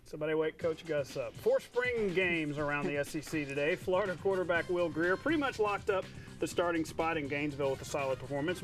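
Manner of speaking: normal talking voice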